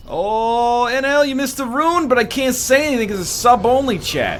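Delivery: high, loud hammed-up complaint; exaggerated high-pitched imitation of a frantic viewer; mock non-subscriber. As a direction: pitch it way up and fret loudly. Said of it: "do an impression of your non-subscribers".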